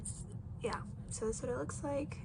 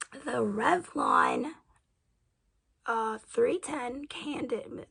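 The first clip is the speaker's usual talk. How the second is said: loud, slow announcement; 8 dB louder, much slower than usual. Real, not acted. Announcing the foundation shade.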